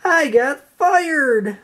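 high, slow wail; slow, high lament. A narrator's reading. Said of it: "SpongeBob wailed".

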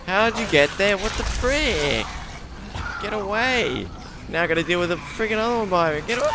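high, loud frantic yelling; panicked screaming. Real, not acted. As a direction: yell in real panic. Monsters closing in.

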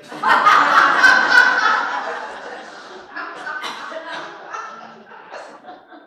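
loud high laugh. Real, not acted. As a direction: burst out laughing. Laughs after punchline.

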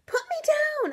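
high panicked yell; frantic, high-pitched cry; scream. A narrator's reading. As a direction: scream to be let go. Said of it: "I screamed".

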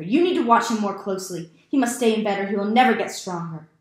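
loud, fast commanding scold; father's angry order. A narrator's reading. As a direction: bark orders at the stepmother.

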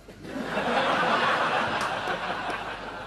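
high breathy burst of laughter; helpless giggle; big laugh. Real, not acted. Laughing at own story.

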